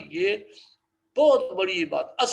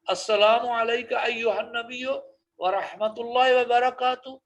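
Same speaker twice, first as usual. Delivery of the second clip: slow, measured Arabic recitation; 5 semitones higher, 4 dB louder, slower than usual. Shown as a demonstration. Teacher reciting the greeting for students, slow. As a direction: intone it slowly and clearly. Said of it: "یہ پڑھ دیا کریں".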